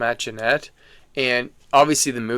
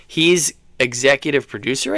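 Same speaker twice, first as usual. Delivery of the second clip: normal talking voice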